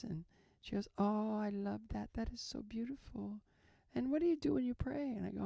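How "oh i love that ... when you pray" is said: higher, softening voice of a delighted odd nurse. Retold or imitated. Nurse admires patient's prayer, imitated high and softly. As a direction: imitate a nurse sweetly approving, pitched up. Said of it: "she had a weird energy to her".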